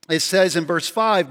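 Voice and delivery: ordinary delivery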